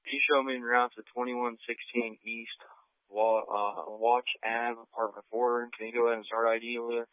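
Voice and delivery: muffled, quiet voice through a radio; police radio. Real, not acted. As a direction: patrol officer on the radio, quick at the end. Officer radioing dispatch with request.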